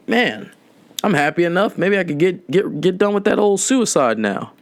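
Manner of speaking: mock cheerful calm. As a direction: say it breezily like it's nothing. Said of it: "you ever say that in a calm voice".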